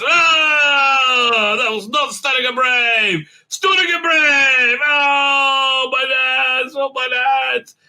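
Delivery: high, hammed-up agonised wail; exaggerated tearful yelping; mock wailing in pain. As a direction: whine like a man hit in the groin, drawn out. Acts man hit in nads.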